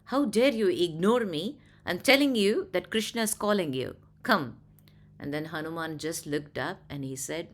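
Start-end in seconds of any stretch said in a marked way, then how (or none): 0.0-4.6 raised, angry challenge; threatening. Acted. Garuda threatens Hanuman to come, loud and angry. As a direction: demand it with a threat in the voice. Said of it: "he threateningly told him".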